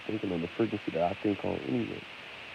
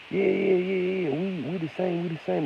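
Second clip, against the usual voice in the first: high, eager little dog voice; dog's voice; 10 semitones higher than usual. Retold or imitated. The dog telling the wolf they're the same, high and eager. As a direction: squeak it like a dog sucking up to a wolf. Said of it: "and telling a wolf".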